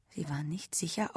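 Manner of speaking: ordinary delivery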